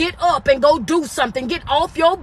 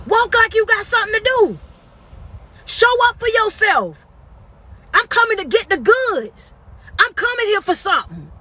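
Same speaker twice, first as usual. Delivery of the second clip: fired up; 7 semitones higher than usual. Real, not acted. Fired-up motivational declaration.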